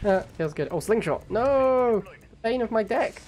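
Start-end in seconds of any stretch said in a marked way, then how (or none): 1.3-3.2 high, drawn-out playful wail; theatrical anguish at a game setback; mock dismay. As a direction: lament it like a hammed-up tragedy. Mock dismay at enemy slingshot appearing.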